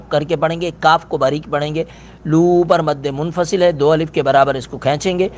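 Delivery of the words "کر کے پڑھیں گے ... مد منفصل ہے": ordinary talk, nothing marked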